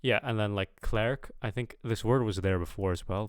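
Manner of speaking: ordinary delivery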